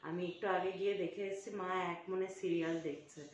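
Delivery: conspiratorial hush, planning a surprise; hushed secret talk. Real, not acted. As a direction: keep it very soft so mom can't hear. Hiding surprise gift from mother. (মায়ের কাছে লুকিয়ে, খুব নিচু গলায় ফিসফিসে কথা)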